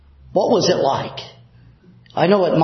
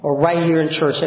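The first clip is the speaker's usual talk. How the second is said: ordinary delivery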